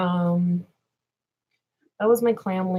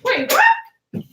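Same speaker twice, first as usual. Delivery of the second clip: gasped, much higher wait what; startled; 7 dB louder than usual. Real, not acted. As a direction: jump at the call, startled. Gasp, startled reply.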